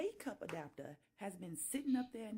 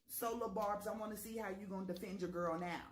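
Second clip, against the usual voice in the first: usual speaking voice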